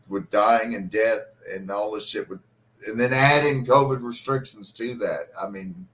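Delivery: usual speaking voice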